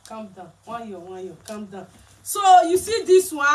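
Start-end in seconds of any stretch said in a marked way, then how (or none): 0.1-1.9 much softer and lower, hushing; quiet calm down, calm down; soothing hush. Real, not acted. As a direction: settle someone down gently, almost under the breath.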